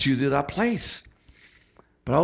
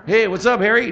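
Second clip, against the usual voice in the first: louder, higher, chummy hey to the judge; mock casual greeting; 6 semitones higher, 5 dB louder than usual. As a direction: greet the judge like an old pal, loud. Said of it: "you know, you know".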